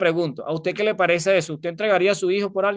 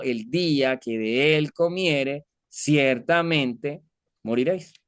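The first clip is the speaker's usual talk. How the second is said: slow, weighty quoting of God's decree; slow solemn Bible quotation; solemn scripture quote; much slower than usual. Retold or imitated. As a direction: read it out slowly like a sentence passed.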